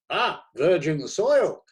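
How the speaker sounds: high, loud and slow; delighted editor. A narrator's reading. Editor delights in the ignorant critic, loud and slow. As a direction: savour it with relish.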